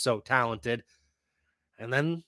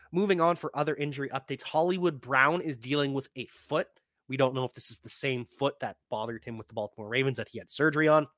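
usual speaking voice

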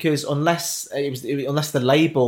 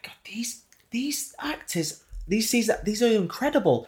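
awed whisper; 9 semitones higher, 4 dB quieter, slower than usual. Retold or imitated. Awestruck at West End actors.

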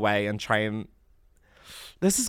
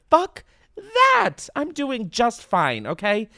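defiant outburst; 9 semitones higher, much slower than usual. Retold or imitated. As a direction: shout it off in defiance, then drag it out. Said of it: "and I was like".

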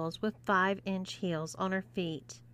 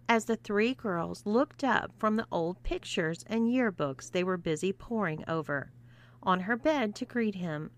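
usual speaking voice

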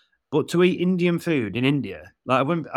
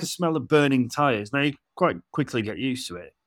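usual speaking voice

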